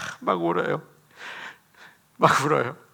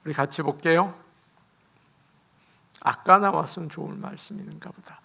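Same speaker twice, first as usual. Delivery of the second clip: ordinary talk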